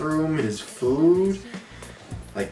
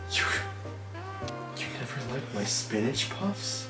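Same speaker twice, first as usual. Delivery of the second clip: hurt quiet voice; 8 semitones higher, 10 dB quieter than usual. Retold or imitated. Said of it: "Kronk's like".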